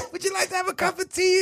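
put-on very high, sweet old lady offering tea; hammed-up granny voice, way up high; squeaky old lady. Friend repeats the old lady's tea offer, squeaky high granny voice. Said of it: "She goes".

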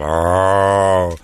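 long, droning group "oh"; imitated crowd exhale. Old audience exhaling in unison, imitated groan. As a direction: let out a sustained communal groan. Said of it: "They'd exhale some noise".

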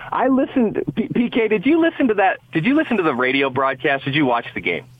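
normal talking voice

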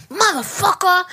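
retold exasperation. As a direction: curse in frustration, reenacting getting mad. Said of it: "I got mad because I meant to say".

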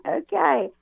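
a high, drawn-out okay from wary parents; mock wary okay. Parents answering the child's prediction, high and wary. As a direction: humour the strange child with a lifted okay.

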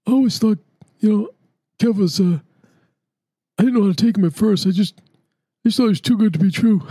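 high voice put on for a friend; imitating his talk. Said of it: "he talks like that".

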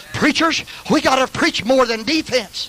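high-pitched pulpit exhortation; fervent preaching. Real, not acted.

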